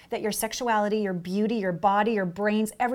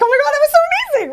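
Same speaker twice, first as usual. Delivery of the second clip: much higher, louder and fast, gushing; giddy gush; 11 dB louder, faster than usual. Retold or imitated. Woman gushing after a great date, high giddy squeal. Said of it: "you're like".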